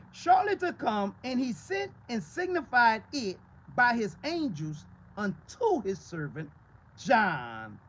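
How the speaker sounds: slow, loud reading of the verse; emphatic scripture reading. Real, not acted. Preacher reading Revelation to the church, slow and loud. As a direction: read the verse slowly with weight.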